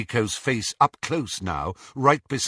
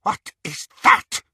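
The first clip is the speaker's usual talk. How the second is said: loud, voiceless hiss; intense hissed demand; 6 semitones higher, 6 dB louder than usual. A narrator's reading. Bellatrix spotting something, hissing. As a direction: hiss it emphatically.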